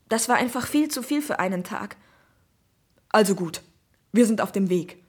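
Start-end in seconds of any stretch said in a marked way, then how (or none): 3.1-5.0 policeman's voice. Acted. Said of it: "antwortet Kommissar Boulot".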